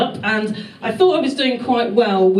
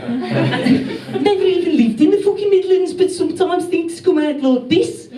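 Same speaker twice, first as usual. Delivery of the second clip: higher-pitched put-on Brummie accent; 8 semitones higher than usual. Shown as a demonstration. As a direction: break into comic Brummie. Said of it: "I caught a Brummie accent".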